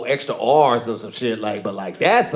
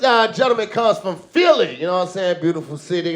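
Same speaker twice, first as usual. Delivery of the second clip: normal talking voice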